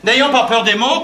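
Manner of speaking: normal talking voice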